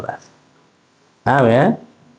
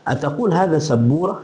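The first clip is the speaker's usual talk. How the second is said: higher-pitched question rising at the end; "ataqul hadha sabura" pitched up, questioning; question intonation; 4 semitones higher, 4 dB quieter than usual. Shown as a demonstration. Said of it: "Tapi intonasinya kan mengatakan".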